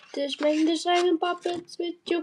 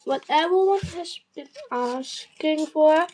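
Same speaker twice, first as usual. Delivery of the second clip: ordinary talk, nothing marked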